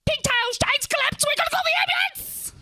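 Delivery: high, loud cry of alarm; panicked scream. Acted. Screaming to Pigtail that a friend collapsed, panicked scream. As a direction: scream for help at the top of your voice. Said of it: "and I scream".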